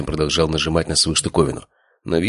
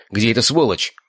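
raised, heated voice; angry demand; 6 semitones higher than usual. A narrator's reading. Father questioning injured servant about culprit, angry. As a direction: demand it angrily with lifted pitch. Enraged father hunting his brother.

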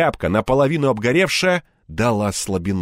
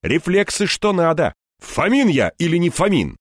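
higher, lively bravado; self-encouraging; 6 semitones higher than usual. A narrator's reading. Hero cheering himself on, spirited bravado. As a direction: pump yourself up. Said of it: "подбодрил я сам себя".

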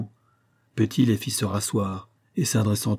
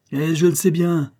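higher, louder exasperated cry; exclaiming angrily; 7 semitones higher, 4 dB louder than usual. A narrator's reading. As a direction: throw it out in exasperation. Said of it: "s'écria l'instituteur".

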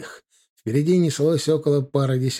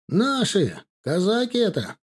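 high-pitched call from afar; 8 semitones higher than usual. A narrator's reading. Lookout up a tree to comrades below, calling out. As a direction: call the news down from a treetop. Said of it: "крикнул с дерева Блохин".

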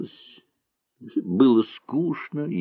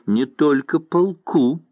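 sharp, spiteful and louder; 6 semitones higher, 3 dB louder than usual. A narrator's reading. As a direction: spit it out with malice. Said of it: "злобно сказала Нина". (злобно, громче и выше)

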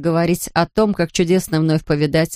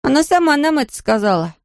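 put-on insistent little boy; 8 semitones higher than usual. A narrator's reading. Boy Patrick insisting in story.

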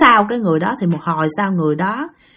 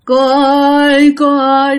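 slow, stretched moan, higher and louder; ghostly call; 5 semitones higher, 4 dB louder, slower than usual.

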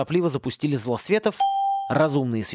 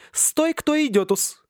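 high, bright-hearted bossy teacher herding freshmen; a gleeful, teasing headmistress with a laugh; jolly teacher voice; 13 semitones higher, slower than usual. Acted. Teacher cheerfully bossing the seasick freshmen, high and teasing.

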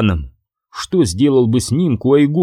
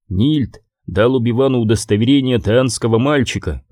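plain reading voice

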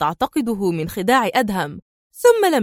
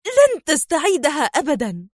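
higher-pitched, mildly angry defiance; pained angry cry; 5 semitones higher than usual. A narrator's reading. Sonia holding her hurt wrist, angrily defiant. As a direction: cry out in anger and pain, held in. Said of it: "صاحت سونيا في مزيج من الغضب والألم".

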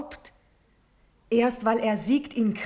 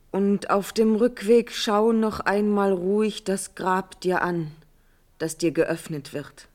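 ordinary delivery

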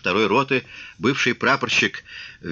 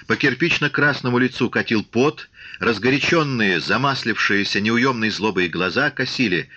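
ordinary delivery

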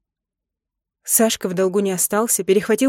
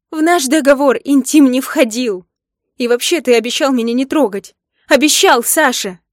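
loud, high-pitched protest throughout; angry reproach; 5 semitones higher, 7 dB louder than usual. A narrator's reading. Wife reminds husband of their no-intimacy agreement, angry reproach. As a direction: hammer home the accusation.

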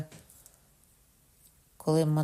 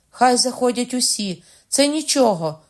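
high, loud cry; shouted; 7 semitones higher, 6 dB louder than usual. Acted. Bride shouts at everyone through the door, loud. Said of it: "крикнула я".

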